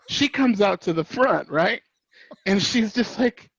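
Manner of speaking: ordinary talk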